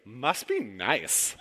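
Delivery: slow, drawn-out envious must be nice; coworker's catchphrase. Retold or imitated. Pastor mimicking a coworker's jealous catchphrase, slow and sour. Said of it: "And Susie goes".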